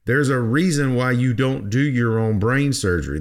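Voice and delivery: ordinary talk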